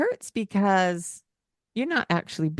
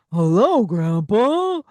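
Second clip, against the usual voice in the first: hello called out louder, bouncing up; playful loud hello; 4 semitones higher, 6 dB louder, slower than usual. Shown as a demonstration. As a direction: boom it out playfully. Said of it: "And just be playful".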